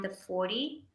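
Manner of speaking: ordinary delivery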